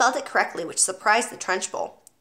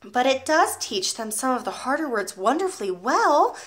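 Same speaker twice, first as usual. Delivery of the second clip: ordinary delivery, nothing marked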